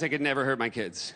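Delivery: put-on low, falling "never hurt my kids"; toned-down assurance. Comic reassures the audience about own kids, toned-down assurance.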